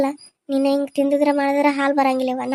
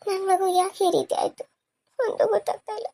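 soft, high, tearful lament; quiet weeping; 5 semitones higher, 5 dB quieter than usual. Acted. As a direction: weep softly to yourself over your child. Mother laments her baby's illness. (ಮೆಲುದನಿಯಲ್ಲಿ ಅಳುತ್ತಾ)

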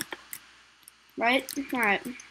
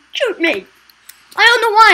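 ordinary talk, nothing marked

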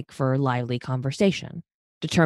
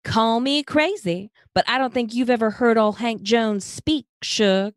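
Southern belle voice; 9 semitones higher than usual. Acted. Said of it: "though still using her Southern belle voice".

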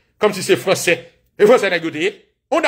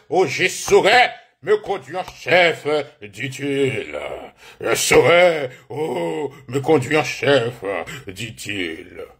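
slow, low mock-grand French recital; stately French quotation, sinking low at each end; mock-pompous recital; 8 semitones lower, much slower than usual. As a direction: draw it out like a solemn history book. Mock recital of French history quote.

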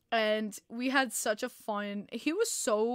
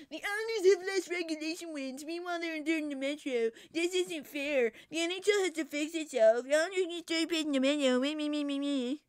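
whiny mocking rival fan, pitched up; Rangers fan taunt; 5 semitones higher than usual. Streamer mocking Rangers fans' posts in a whiny voice. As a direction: mimic a bratty complaining fan. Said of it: "When you did the Rangers taunt".

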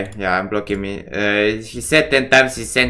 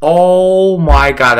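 slow, loud drawn-out groan; real frustration; exasperated groan; 5 semitones higher, 8 dB louder, much slower than usual. Real, not acted. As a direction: drag it out in exasperation. Lost right after starting.

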